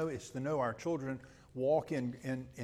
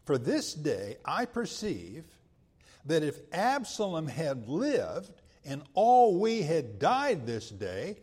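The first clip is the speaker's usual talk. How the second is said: rising to a forceful rebuke, louder and higher; scripture reading, preaching force; 3 semitones higher, 5 dB louder than usual. Acted. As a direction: read Joab's rebuke with mounting force. Reads Joab's rebuke to David.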